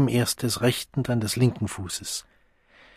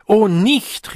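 high, loud frightened protest; alarmed, quavering cry; trembling plea; 9 semitones higher, 6 dB louder than usual. A narrator's reading. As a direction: cry out while being shaken. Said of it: "ihre Stimme bebte lächerlich".

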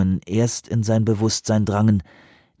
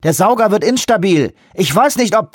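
raised, higher, over the loudspeaker; a pilot on the radio trying to stay on top of a crisis; urgent pilot report; 10 semitones higher, 3 dB louder than usual. Acted. Pilot reports unstable suction over speaker.

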